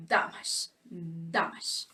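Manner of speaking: "damage, damage" whispered with DAM stressed; whispered demo. Shown as a demonstration. Teacher quietly whispering the model word twice. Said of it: "this is where the sound of the word goes".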